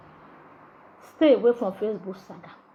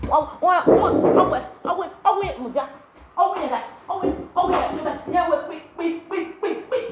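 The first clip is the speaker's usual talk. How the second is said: mock babble; 4 semitones higher than usual. Mocking babble sounds.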